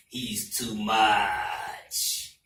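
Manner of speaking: put-on very slow, low Ghanaian accent. Ghanaian coworker sums up Donald Trump, slow serious Ghanaian accent. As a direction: imitate a serious Ghanaian, stretching every word. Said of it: "Just three words".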